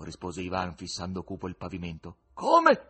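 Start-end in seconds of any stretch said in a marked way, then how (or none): 2.3-2.9 high, loud shout of astonishment; sudden startled yell; shocked cry. A narrator's reading. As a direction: cry out, forgetting all caution. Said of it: "gridò l'ospite, dimenticando la prudenza".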